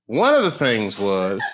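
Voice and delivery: ordinary talk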